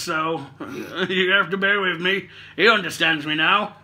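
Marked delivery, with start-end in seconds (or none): none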